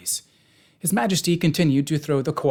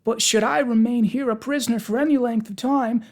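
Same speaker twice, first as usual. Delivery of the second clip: high-pitched, anxious protest; 11 semitones higher, 4 dB louder than usual. A narrator's reading. Pumpkinhead objecting to being held prisoner, protesting. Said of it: "protested Jack".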